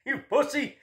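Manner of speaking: high, mocking jeer through a laugh; heckling fan. Fan Claudio heckling the kid who backed out, high mocking jeer. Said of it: "my man Claudio back there".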